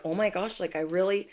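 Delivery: a hushed, near-tearful oh my gosh; happy tears. Pretend. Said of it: "feel these happy tears".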